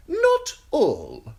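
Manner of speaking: high eunuch voice, Not stressed with a lift; a knowing high Not all; high sly Varys. Acted. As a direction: Varys coyly confirming to Tyrion.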